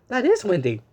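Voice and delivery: ordinary delivery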